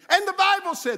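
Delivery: high, loud pulpit cry; imitated preacher at full pitch; stirred-up young preacher. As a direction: launch in shouting like a worked-up preacher. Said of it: "stirred up again".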